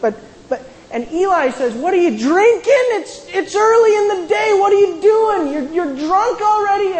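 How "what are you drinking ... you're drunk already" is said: indignant shout. Retold or imitated. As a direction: bark it out as a scandalised old priest. Priest accuses praying woman.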